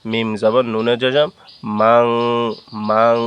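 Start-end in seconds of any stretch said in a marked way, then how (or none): 1.8-3.2 "মাং" with the ending held as a long nasal hum; nasal ghunnah. Shown as a demonstration. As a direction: hum through the nose on the end of "মাং". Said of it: "গুন্নার সহিত ইকফা করে পড়ব".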